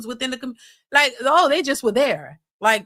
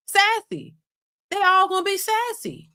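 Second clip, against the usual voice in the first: sassy; 8 semitones higher than usual. Real, not acted. Searching for the word.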